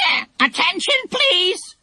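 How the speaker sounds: high squawking parrot calling for order; stern parrot. Acted. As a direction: parrot playing schoolmaster. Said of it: "said Kiki sternly".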